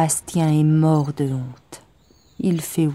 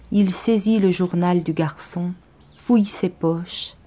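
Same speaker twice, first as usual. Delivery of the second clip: usual speaking voice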